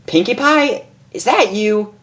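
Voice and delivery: Rainbow Dash voice. Acted. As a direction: call up, half surprised. Rainbow calls to Pinkie.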